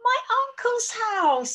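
high, excited princess voice; Princess Pearl. Acted. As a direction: call it out high and eagerly. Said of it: "said Princess Pearl".

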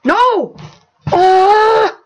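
high, loud cry of dismay; real outburst, voice leaping up; anguished shout. Real, not acted. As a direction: yell out as the game goes wrong. Something goes wrong in game.

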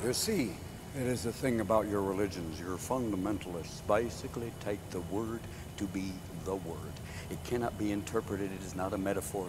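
very low, quiet dogmatic drone; hushed fundamentalist. Acted. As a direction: lay down doctrine in a low, soft monotone. Acted fundamentalist explaining scripture.

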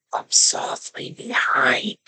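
soft unvoiced whisper. A narrator's reading. Reader to children, hunters sneaking, whispering. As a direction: breathe the words like sneaking up.

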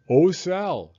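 put-on loud, high greeting of an old neighbor; old lady talking up to a child; elderly neighbor greeting a shy girl loudly. A narrator's reading. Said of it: "made her voice louder and slower".

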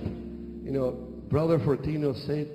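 ordinary talk

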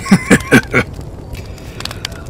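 very high unvoiced cackle; shrill laugh. Lord laughing at the fashion quip, high cackle. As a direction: cackle at the joke.

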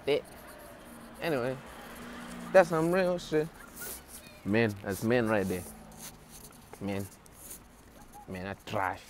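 ordinary talk

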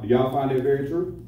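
usual speaking voice

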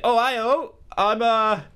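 high-pitched comic Australian character; mock Australian accent. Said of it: "he sounds quite Australian".

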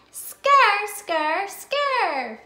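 "Scur" called out high and loud; loud phonics sound. Shown as a demonstration. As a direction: belt out "Scur" for the class. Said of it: "says scur".

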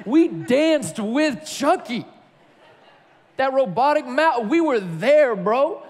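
high-pitched, indignant retold plea; hurt, shocked protest. Boy dropped by his best friend, protesting in hurt. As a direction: plead your case to a friend, hammed up and high. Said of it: "I was so hurt and confused and shocked".

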